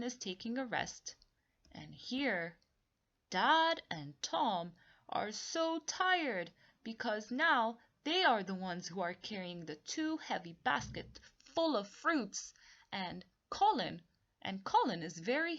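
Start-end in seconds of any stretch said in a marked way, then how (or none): none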